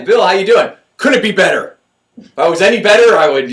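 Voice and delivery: loud, high, upbeat back-and-forth; hearty greeting. Retold or imitated. Greeting Bill and hearing his answer, loud and hearty. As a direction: boom it out cheerfully. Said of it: "I say".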